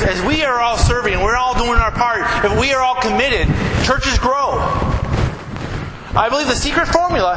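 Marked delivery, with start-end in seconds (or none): 0.0-3.5 raised, ringing preacher's voice, pushing higher; fervent preaching. Acted. Pastor urging his congregation, fervent preaching. 6.2-7.4 raised, ringing preacher's voice, pushing higher; fervent preaching. Acted. Pastor urging his congregation, fervent preaching.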